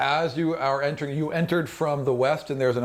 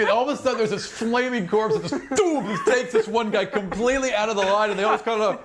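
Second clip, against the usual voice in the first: laughing narration; 9 semitones higher than usual. A narrator's reading. GM narrates comic dancing corpse scene.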